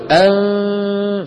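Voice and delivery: أن with a very long nasal hum; held nasal ghunna. Shown as a demonstration. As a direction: draw out أن slowly through the nose. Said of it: "في غنة".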